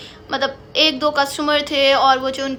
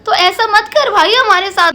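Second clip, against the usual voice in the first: exasperated plea; 4 semitones higher, 7 dB louder than usual. Retold or imitated. Pleading with the shop clerk.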